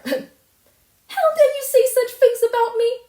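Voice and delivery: high, loud, tearful screaming; vehement shriek. Acted. Furious girl screaming at the neighbour, vehement shriek. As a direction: let the rage explode. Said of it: "she repeated vehemently".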